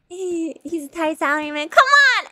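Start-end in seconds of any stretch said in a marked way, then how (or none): 1.7-2.2 high, loud outburst; exclaimed protest. Real, not acted. Player protesting a game character's treatment, high and loud. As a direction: burst out in playful protest.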